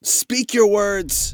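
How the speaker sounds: slow, high-pitched command; imperious woman leader. Acted. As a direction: demand it high and drawn out. Woman leader challenges the enemy.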